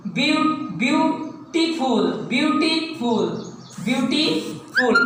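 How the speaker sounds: ब्यूटीफुल said slowly, broken into ब्यू-टी-फुल; slow teacher demo of ब्यूटीफुल; syllable by syllable. Shown as a demonstration. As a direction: spell out the beats of ब्यूटीफुल. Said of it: "एक syllable हो गया ब्यूटी".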